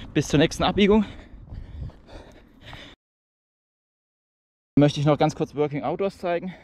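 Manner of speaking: talking while jogging; while running. Real, not acted.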